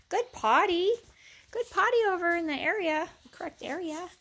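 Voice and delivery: high, loud sing-song praise for the puppy; high-pitched pet talk. Real, not acted.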